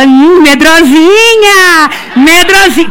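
high, slow, loud teasing chant; mother's cheeky girl teasing, high and drawn out; teasing singsong. Retold or imitated. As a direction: taunt the scared mother like a cheeky kid.

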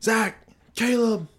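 dad calling out, high and drawn out; imitated high-pitched holler; retold yelling. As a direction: holler the names slowly up the house. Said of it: "I hear him yelling".

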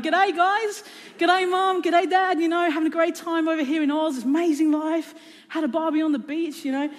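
hammed-up Aussie twang calling home; Australian accent. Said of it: "I had adopted an Australian accent".

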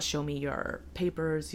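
normal talking voice

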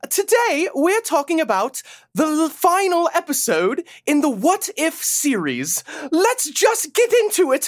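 put-on high-pitched, theatrical British accent; Peggy Carter. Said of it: "it's Peggy Carter here".

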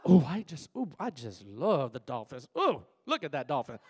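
low swooning coo over the Dolphins; mock gushing fan. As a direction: croon it like a lovesick fan. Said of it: "It is not".

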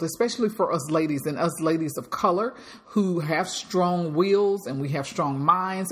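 normal talking voice

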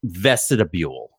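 "Vestibule" slow and low with an extra syllable; imitated Boston pronunciation. Store announcer's word, "Vestibule" with an added syllable. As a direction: stretch "Vestibule" into four syllables, ves-ti-da-bule. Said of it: "thickest Boston accent, and he would always say".

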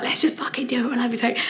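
urgent whispered dare. Retold or imitated. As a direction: hiss it under your breath. Urging overtake, hushed in car.

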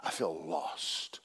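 slow, low, hushed despair; a quiet sinking confession; feeling lost. Retold or imitated. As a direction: drag out the lostness. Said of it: "all of a sudden".

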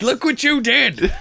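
high-pitched blame with a laugh; joke-angry cry, voice shot up high; hammed-up accusing wail breaking into laughter; mock accusation.

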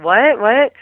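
high, loud mock "what?"; acting out startled friends, pitch jumping up; hammed-up confused questioning; imitated bafflement. Friends' baffled reaction, acted out.

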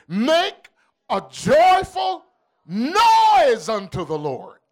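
slow, emphatic psalm recital; preached scripture. Acted. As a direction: stretch out the verse for the congregation.

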